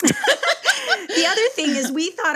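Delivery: laughing through it. Real, not acted. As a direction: chuckle as you start the story. Laughing while starting the story.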